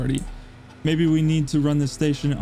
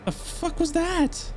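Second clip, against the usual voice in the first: high, startled question, drawn out; sudden high-pitched puzzlement; 11 semitones higher, 4 dB quieter, slower than usual. Real, not acted. Player reacting to a strange noise, startled.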